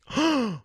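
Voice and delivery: a sudden sharp gasp of shock. Real, not acted. Fan gasps recalling the movie twist, sharp gasp. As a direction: gasp as if the twist just hit you.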